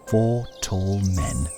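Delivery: usual speaking voice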